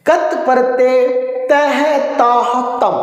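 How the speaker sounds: slow and drawn out; slow call to repeat. Shown as a demonstration. Teacher leads students to chant along with him. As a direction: lead the class, stretching each syllable. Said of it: "मेरे साथ में उच्चारण करो प्यारे दोस्तों".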